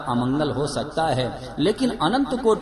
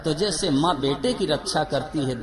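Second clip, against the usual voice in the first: ordinary talk, nothing marked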